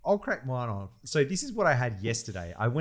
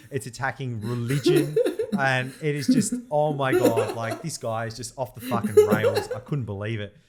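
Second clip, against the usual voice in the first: ordinary talk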